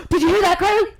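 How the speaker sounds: high, loud, excited call down the phone; excited phone exclamation. Retold or imitated. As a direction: imitate an excited friend yelling over noise. Re-enacts Brandon's excited phone call.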